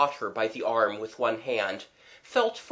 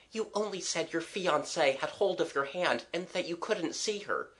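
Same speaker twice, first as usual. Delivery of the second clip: put-on soft, higher girl's voice; reassuring Kathleen; 6 semitones higher, 5 dB quieter than usual. Kathleen telling him what he said, soft and reassuring. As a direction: reassure him softly, pitched up.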